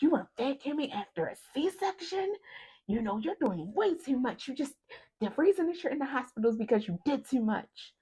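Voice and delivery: high-pitched, incredulous telling-off; scolding nurse. Retold or imitated. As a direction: imitate a nurse scolding in disbelief. Nurse scolding her, retold.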